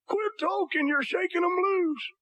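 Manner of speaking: high, slowed voice of a fussing dentist; high, drawn-out comic dentist; mock dentist. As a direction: pitch it way up like a scolding dentist.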